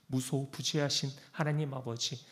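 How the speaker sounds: normal talking voice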